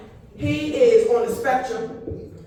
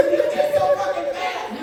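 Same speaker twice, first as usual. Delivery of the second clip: high exclaim; 3 dB louder than usual. Real, not acted.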